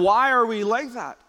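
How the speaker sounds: ordinary talk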